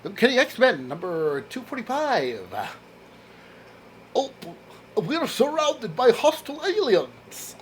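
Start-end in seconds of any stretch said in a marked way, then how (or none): 4.1-7.5 high-pitched mock Russian accent. Reading a comic about hostile aliens, Russian accent. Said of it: "that's my Russian accent".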